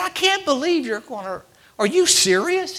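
can't believe what you're hearing, drawn out; incredulous. Retold or imitated. Telling the game warden in high, stretched disbelief.

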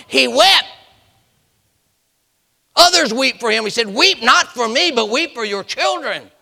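high, loud pulpit cry; impassioned preacher in full cry; full-force preaching. Real, not acted.

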